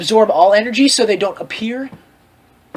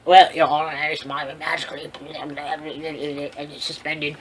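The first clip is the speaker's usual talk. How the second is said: lower, trailing mock plea to a judge; mock defendant; 7 semitones lower, 9 dB quieter than usual. Character pleading weakly to a judge, fading out.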